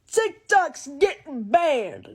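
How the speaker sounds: mocking people who keep warning, slow sing-song. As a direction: drag it out mockingly. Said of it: "Stop saying".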